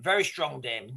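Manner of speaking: ordinary talk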